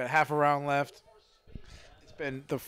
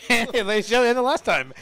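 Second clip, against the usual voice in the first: announcer voice; 8 semitones higher, 6 dB louder than usual. Pretend. Mock announcing, laughing.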